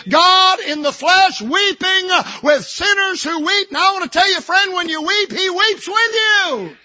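impassioned sermon peak on weeping; full-force preaching. Acted. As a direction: pour everything into the climax.

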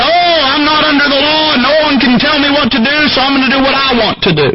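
high-pitched, hurried defiance; mock defiant believer. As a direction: mimic someone refusing to be told. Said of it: "those folks that are saying".

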